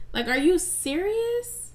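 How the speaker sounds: are you serious, stretched high in disbelief; exasperated disbelief. Real, not acted. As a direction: drag it out like you can't believe it. Outraged listener reacting to betrayal.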